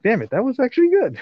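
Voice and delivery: suddenly high and amazed; surprised. Real, not acted. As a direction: pitch jumps up in amazement. Surprised praise.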